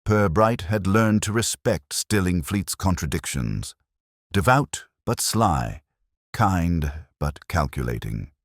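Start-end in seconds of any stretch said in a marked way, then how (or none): none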